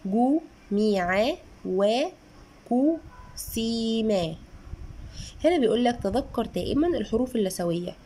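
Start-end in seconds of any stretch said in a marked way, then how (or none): 0.1-1.4 sounding out. Shown as a demonstration. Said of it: "اداني تاني تمارين". 1.7-4.3 sounding out. Shown as a demonstration. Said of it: "اداني تاني تمارين".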